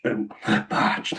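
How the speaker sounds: "outmatched" breaks into a voiceless rasp; voice giving out. Real, not acted. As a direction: let the voice crack away on "outmatched". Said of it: "I'm losing my voice".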